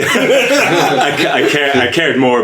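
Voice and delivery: self-deprecating laugh through the words. Real, not acted. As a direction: chuckle while admitting it. Laughs at own programming quality.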